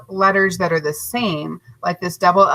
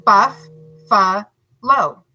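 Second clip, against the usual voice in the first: "Buff, fa, lo" each syllable separate, "Buff" louder; syllables split apart; 4 dB louder, slower than usual. Shown as a demonstration. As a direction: break "Buff, fa, lo" apart for the kids. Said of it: "look at how it's broken apart".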